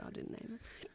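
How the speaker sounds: whispered aside. Real, not acted. Disowning the cat's name.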